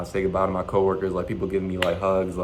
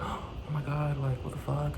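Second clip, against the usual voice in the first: soft, higher mock disbelief; imitated hushed shock; 4 semitones higher, 10 dB quieter, slower than usual. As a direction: play the stunned coworker under your breath. Voices coworker shocked at news.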